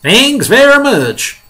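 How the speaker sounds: high, loud, drawn-out sarcastic retort; snapping. Acted. Fire chief refusing the ladder job, snapped retort. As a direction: snap it back loudly, stretched. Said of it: "snapped the head of the fire department".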